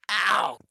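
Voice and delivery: hammed-up pained ow. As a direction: yelp "Ow." like a hit landed. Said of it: "I'm literally going".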